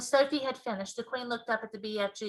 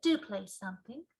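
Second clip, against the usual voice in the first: plain reading voice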